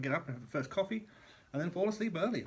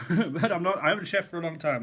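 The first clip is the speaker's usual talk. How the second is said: laughing; 5 semitones higher, 7 dB louder than usual. Real, not acted. Laughing aside about being chef.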